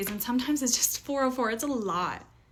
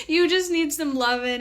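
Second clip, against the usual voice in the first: laughing; 6 semitones higher, 6 dB louder than usual. Real, not acted. Laughing at her own remark.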